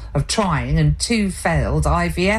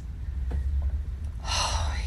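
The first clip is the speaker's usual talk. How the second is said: long, soft unvoiced stagey sigh; an exaggerated fed-up sigh; theatrical sigh; 10 dB quieter than usual. A narrator's reading. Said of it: "gave a theatrical sigh".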